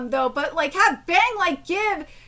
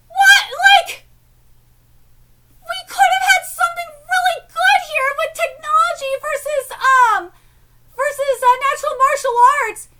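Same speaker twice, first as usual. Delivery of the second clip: exasperated shout; 8 semitones higher, 5 dB louder than usual. Real, not acted. Frustrated rant at manga.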